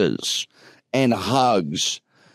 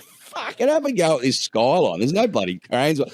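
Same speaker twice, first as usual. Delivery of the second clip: retold skyline remark; 7 semitones higher than usual. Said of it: "I said".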